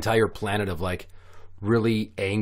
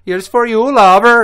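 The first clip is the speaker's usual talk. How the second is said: high, loud hammed-up lover's cry; triumphant shout; 12 semitones higher, 13 dB louder than usual. Alien woman flips astronaut over, mock triumphant shout. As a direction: belt it out as a conquering alien.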